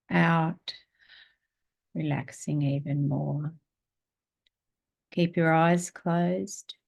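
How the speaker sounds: slow meditation voice with long pauses; guided meditation. Real, not acted. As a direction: guide them slowly through each breath, letting words settle. Leads breathing meditation.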